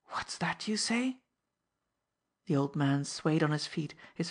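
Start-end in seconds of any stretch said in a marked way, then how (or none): 0.1-1.2 breathless, stunned whisper; voiceless gasp of shock. Acted. Old minister whispering his shock at the news. Old man hears of death.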